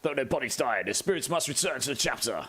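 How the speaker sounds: Space Marine voice. Retold or imitated. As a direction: intone it like a grim warrior monk. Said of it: "That's basically how they all speak".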